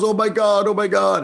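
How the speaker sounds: high-pitched mock alarm; imitated panicked father. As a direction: wail it high like a flustered dad. Father's shocked reaction retold.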